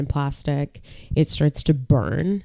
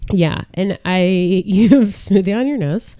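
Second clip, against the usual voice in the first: ordinary talk, nothing marked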